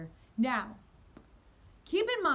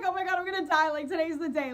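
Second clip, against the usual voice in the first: higher and louder, a frantic retold panic; panicked; 5 semitones higher, 6 dB louder, faster than usual. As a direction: blurt it out as if doom is certain. Convinced she will be murdered.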